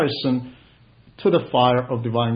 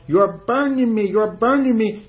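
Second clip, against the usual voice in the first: higher, raised tormented cry, imitating; mock demoniac cry; 7 semitones higher than usual. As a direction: voice the demon crying out in pain, preacher-style. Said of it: "a demoniac crying out".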